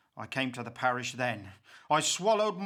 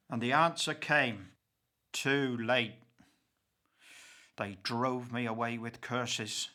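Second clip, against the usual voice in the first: ordinary delivery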